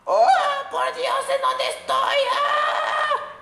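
a very high put-on squeak, ending in a cry; a character thrown somewhere strange, tiny squeaky voice and a final cry; high squeaky cartoon voice. Voicing lost character thrown away.

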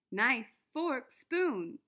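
plain reading voice

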